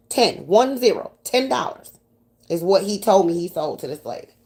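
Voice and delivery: ordinary talk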